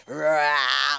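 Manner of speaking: loud growling mock yawn; rhino yawn. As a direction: roar out a big yawn. Imitating husband's rhinoceros yawn.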